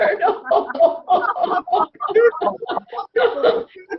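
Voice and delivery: very high laughing; forgiveness laughter. Shown as a demonstration. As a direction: keep the open-hearted laugh going. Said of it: "there's forgiveness laughter".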